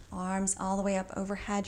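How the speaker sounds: ordinary talk, nothing marked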